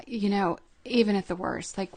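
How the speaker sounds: ordinary talk, nothing marked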